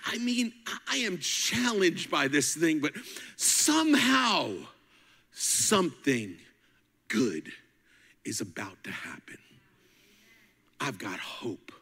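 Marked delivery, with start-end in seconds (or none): 3.4-7.5 slow, spaced-out words; drawn out. Real, not acted. Slow drawn-out build of hope. 8.2-11.7 soft, breathy whisper of hope. Real, not acted. Whispered close.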